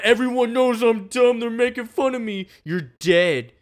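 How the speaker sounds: high, loud hammed-up thug voice; bully threat. Acting out the bully threatening Cyrus, loud mock rage. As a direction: play the angry dumb bully to the hilt.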